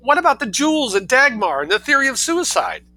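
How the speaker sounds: high, loud scream. Acted. Mother screaming at her son, high scream. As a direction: shriek it at the door. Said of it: "screamed Missus Heasant".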